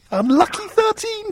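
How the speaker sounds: very high, slow, drawn-out teasing chant; singsong taunt. Acted. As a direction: crow it at him like a playground tease. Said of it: "teasing Wayne about losing his".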